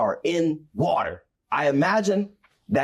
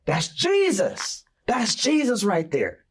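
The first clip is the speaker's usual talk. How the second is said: excited recognition; 10 semitones higher than usual. Retold or imitated. Peter recognizes Jesus across the storm, excitedly. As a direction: call out in thrilled recognition. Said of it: "he's like".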